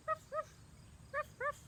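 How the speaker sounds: high, yappy little dog barks. Pretend. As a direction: bark like a small puppy for the kids. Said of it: "I wanna hear you bark".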